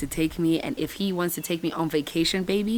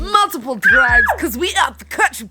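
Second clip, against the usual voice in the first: hyped shouting; 8 semitones higher, 6 dB louder than usual. Real, not acted. Traveller hyping multiple flights and drives with friends, hyped shouting. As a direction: shout it like a party chant. Hyped group farewell to the trip.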